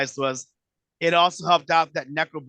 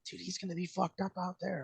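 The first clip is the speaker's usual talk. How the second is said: hushed aside; 3 semitones higher, 12 dB quieter than usual. Retold or imitated. Aside to Vito about New Jack.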